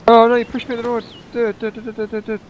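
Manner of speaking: ordinary talk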